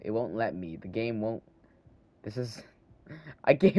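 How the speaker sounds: ordinary delivery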